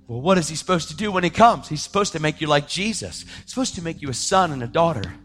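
ordinary talk

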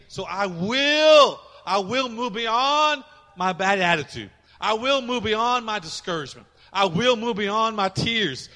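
loud, slow, rising declaration; preaching at full force. Acted. Pastor voicing the believer's vow, full-force preaching. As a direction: proclaim it, each I will hammered.

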